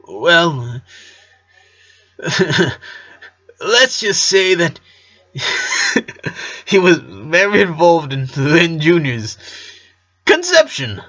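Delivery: a dad squirming with laughter; embarrassed laughing dad. A narrator's reading. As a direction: laugh through the awkward confession.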